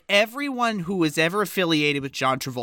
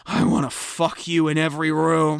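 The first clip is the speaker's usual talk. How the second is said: imitated drunk; 3 semitones lower than usual. Drunk actor propositioning a comedian in her ear, imitated drunkenness. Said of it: "drunk as a skunk".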